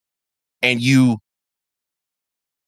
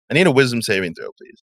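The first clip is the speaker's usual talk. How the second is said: ordinary delivery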